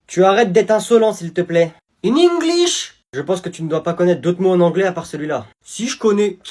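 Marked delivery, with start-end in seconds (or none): 2.0-3.0 higher, louder In English; teacher insisting on English, higher and louder; shrill teacher insist. Acted. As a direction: demand English again, shrill.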